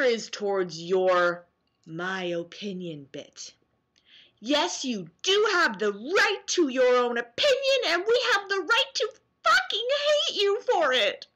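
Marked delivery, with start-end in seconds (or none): 4.4-11.2 higher put-on voice that shoots way up on the second half; mocking hater. Voicing a commenter who hates fans' opinions, mocking and high. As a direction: sneer it like the troll.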